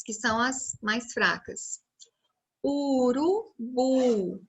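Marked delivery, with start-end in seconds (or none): 2.6-4.4 urubu very slowly, stretched, landing on BU; stress on final syllable. Shown as a demonstration. Teacher showing children the strongest syllable, urubu stretched syllable by syllable. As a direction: sound out u-ru-BU with a long last syllable. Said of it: "o bu é a sílaba mais forte".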